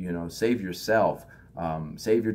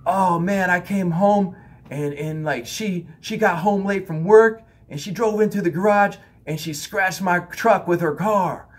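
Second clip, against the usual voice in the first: higher, louder, slow complaining friend; aggrieved friend; 9 semitones higher, 6 dB louder than usual. Retold or imitated. Husband complaining about his wife, higher aggrieved whine. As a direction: whine about the scratched truck.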